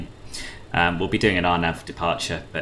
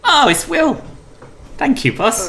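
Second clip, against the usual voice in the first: very high, louder exclamation of recognition; delighted surprise; 4 dB louder than usual. Real, not acted. Streamer recognising a familiar controller on frequency, surprised. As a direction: squeal it out on recognising someone.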